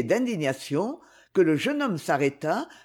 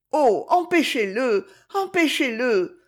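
high, pressing plea to stop him; alarmed girl repeating empêchez-le; urgent plea; 6 semitones higher, 3 dB louder than usual. A narrator's reading. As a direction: beg her to step in, voice lifted. Magali begs Isabelle to intervene.

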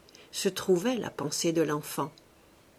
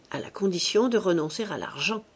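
ordinary delivery, nothing marked

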